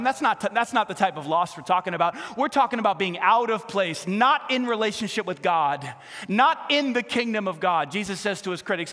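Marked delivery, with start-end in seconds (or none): 0.0-7.9 loud, driving sermon voice; full-force preaching. Acted. Pastor preaches to the congregation, loud and driving. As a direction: drive the point home to the church.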